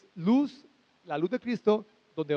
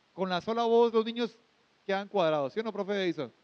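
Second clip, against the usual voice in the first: normal talking voice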